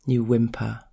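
ordinary delivery, nothing marked

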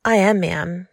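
ordinary delivery, nothing marked

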